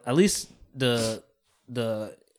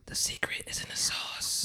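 playful conspiratorial whisper; 9 dB quieter than usual. Real, not acted. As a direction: breathe it like a secret. Whispering back as a joke.

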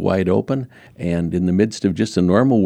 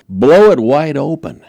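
emphatic; 8 semitones higher, 8 dB louder than usual. Real, not acted. Preacher urging church outward.

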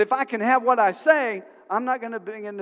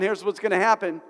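ordinary talk

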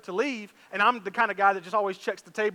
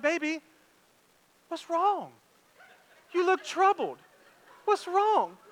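mock sweet concern; 8 semitones higher, slower than usual. Dad faking sweet concern.

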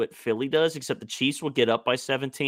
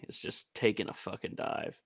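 whispered aside; 3 semitones lower, 10 dB quieter than usual. Real, not acted. Muttered aside.

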